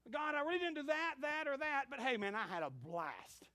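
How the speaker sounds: high and loud, then slow and cheerful; carefree excuse to God. Retold or imitated. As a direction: toss off the excuse, proud of the fun. Careless person answering God.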